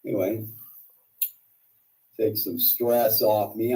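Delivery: normal talking voice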